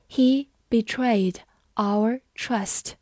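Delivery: very slow, each word set apart; 慢速示范朗读. Shown as a demonstration. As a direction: read it out slowly with clear gaps between words. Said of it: "我们慢读一遍". (放慢速度，逐词朗读)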